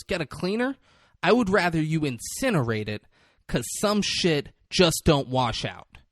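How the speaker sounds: acted disgust, slowing on the last words; scornful boss. Boss rejecting henchman's offer of a cleaner, mock scorn. Acting out boss's scornful reply.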